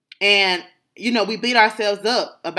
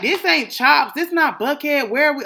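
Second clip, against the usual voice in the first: confused dad; 7 semitones higher than usual. Retold or imitated. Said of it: "Get to the restaurant".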